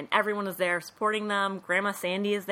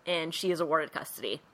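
ordinary talk, nothing marked

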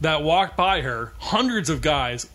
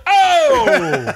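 shocked gasp; 13 semitones higher, 9 dB louder than usual. Retold or imitated. Shocked by vulgar comment about daughter.

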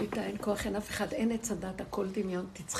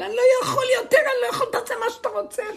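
high, loud exasperated yell of the bank manager; retold shout; 14 semitones higher, 12 dB louder, faster than usual. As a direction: blow up like a manager at the end of his rope. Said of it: "פתאום הוא צועק". (צעקה גבוהה ורמה של מנהל שנשבר)

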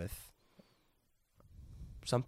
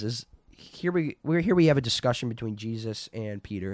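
ordinary talk

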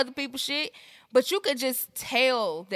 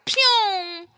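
dog zooming off sound; 8 semitones higher, 4 dB louder than usual. Retold or imitated. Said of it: "that motherfucker's like".

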